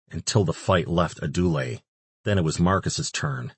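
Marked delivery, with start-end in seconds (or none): none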